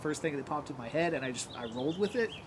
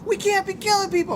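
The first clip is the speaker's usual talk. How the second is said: very high, loud outcry; aghast mock protest; 9 dB louder than usual. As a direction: protest in a horrified yell. Teammate protests the killing.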